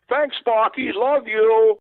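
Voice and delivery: put-on high, loud, gushing Boston accent; the Boston fan shouts his thanks in a Boston accent. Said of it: "you could tell by the accent".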